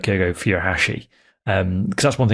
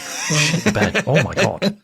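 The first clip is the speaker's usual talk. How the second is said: laughing; 7 semitones higher, slower than usual. Real, not acted. Laughing reaction to joke.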